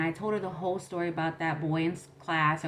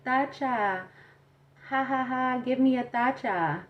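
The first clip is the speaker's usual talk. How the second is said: higher, teasing sing-out with spoken ha-ha-ha; mocking tease; 6 semitones higher than usual. Boy at school teasing her about her nickname, mocking. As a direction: imitate a schoolboy's taunt. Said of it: "would always tease me".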